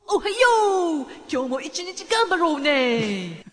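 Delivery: loud, high, drawn-out party shout; party-people Oriradi impression. Co-host cheering listeners on for the day, party-people Oriradi impression. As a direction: belt out a hyped-up morning greeting, very slowly stretched. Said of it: "「あっちゃんかっこいい」".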